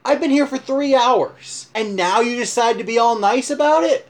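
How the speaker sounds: indignant at the sudden politeness; upset outburst. Retold or imitated. Groom confronting the now-polite manager, indignant. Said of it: "I was so upset".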